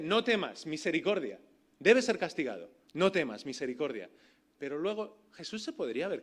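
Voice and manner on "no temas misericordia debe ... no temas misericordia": emphatic preaching. Acted. Preacher proclaiming mercy to the congregation, loud and emphatic. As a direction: preach it out with force. Preacher driving home his point.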